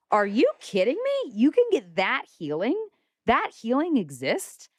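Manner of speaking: high, amazed mock exclaim; stunned disbelief. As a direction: act floored by what you just saw. Amazed retelling of watching readings.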